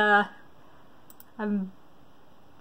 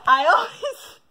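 laughing hard; 7 semitones higher than usual. Real, not acted. Quiz taker reacts to her result, laughing hard. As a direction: try to speak while cracking up.

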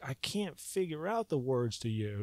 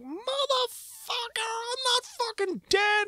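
much higher, slow frantic protest; panicked protest; slower than usual. Retold or imitated. Floating above his body, a high panicked protest. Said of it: "I'm like".

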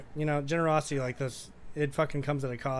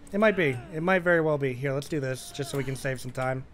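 ordinary delivery

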